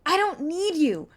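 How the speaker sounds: slow, loud furious outburst; snapping. Acted. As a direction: snap it out to push him away. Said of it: "I snapped".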